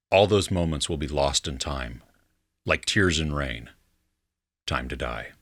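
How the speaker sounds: low, measured film-quote delivery; solemn quote recital. Acted. Quizmaster reads out quote number one, low and solemn.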